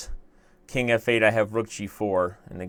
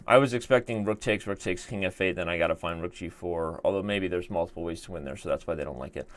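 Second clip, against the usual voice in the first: normal talking voice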